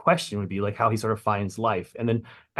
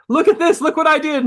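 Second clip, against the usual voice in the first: much higher and louder, eager to show off; proud excited showing off; 8 dB louder than usual. Retold or imitated. Writer showing off his new issue, high and eager. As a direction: call out for everyone to admire it. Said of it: "the issue came out and I was like".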